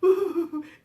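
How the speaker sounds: high mock boo-hoo; pretend sad sobbing. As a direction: wail playfully like a sad spider. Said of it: "It's a sad one".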